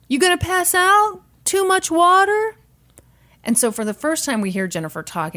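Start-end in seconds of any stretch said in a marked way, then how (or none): 0.1-2.6 mock DJ. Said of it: "D J".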